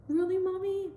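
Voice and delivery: put-on very high child's voice, questioning; a small boy amazed at the news; surprised little boy. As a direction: son surprised mom wants to stop nursing. Son surprised, voiced by mother.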